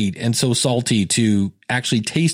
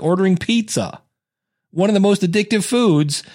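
ordinary talk, nothing marked

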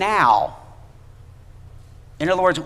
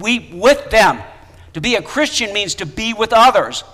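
louder, higher fervent preaching; preaching at full force; 4 semitones higher, 5 dB louder than usual. Real, not acted. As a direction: rise to the sermon's climax. Climax of sermon exhortation.

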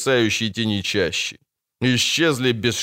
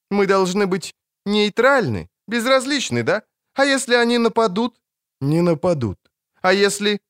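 indignant challenge; 9 semitones higher than usual. Acted. Ciri challenges Geralt.

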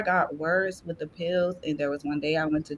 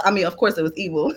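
ordinary delivery

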